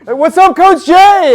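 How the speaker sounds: high, loud excited greeting; excited teen shout. Retold or imitated. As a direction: yell it out a car window. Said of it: "So that just gives you".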